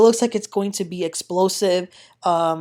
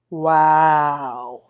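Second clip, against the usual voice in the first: ordinary talk, nothing marked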